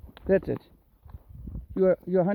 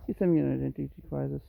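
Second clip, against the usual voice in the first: ordinary delivery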